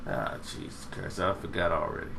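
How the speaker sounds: breathy under-the-breath curse; exasperated mutter. Real, not acted. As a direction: mutter it to yourself, annoyed. Still can't recall the name.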